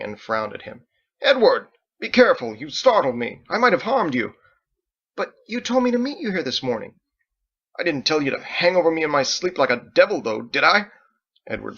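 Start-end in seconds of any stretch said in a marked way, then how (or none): 1.2-4.3 loud, high alarmed scolding; angry startled shout. Acted. Michael, startled awake, shouts at Edward angrily. 5.1-7.0 put-on high young boy voice, pleading; a hurt kid defending himself; boy protesting. Edward protests to Michael in a high boyish voice. 7.8-10.9 loud, fast, sharp rebuke; angry scolding. Acted. Michael scolds Edward loudly and fast.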